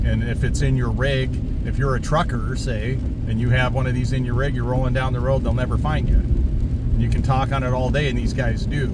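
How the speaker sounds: ordinary delivery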